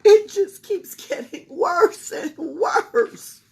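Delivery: high, loud, slow lament; distressed mother's wail; sobbing mother. A narrator's reading. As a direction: keep the sob going. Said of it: "sobbed Missus Cream".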